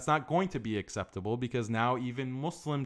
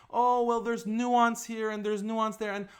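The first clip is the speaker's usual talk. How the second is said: high, mocking sing-song of a hedging person; mock wishy-washy; 8 semitones higher than usual. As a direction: parody the nuance crowd, pitch raised. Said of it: "This wishy-washy attitude that they have".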